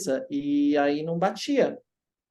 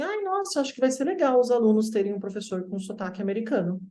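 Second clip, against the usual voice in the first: much higher mimicking voice on the exclamation; gushing impersonation of the woman; lightly mocking delighted teacher; mock excited lady; 7 semitones higher than usual. Retold remark by the woman.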